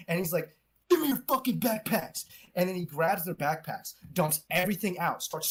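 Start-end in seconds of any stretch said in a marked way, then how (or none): 0.9-2.2 high-pitched mock bully order; retold aggressive command, much higher; imitated angry demand.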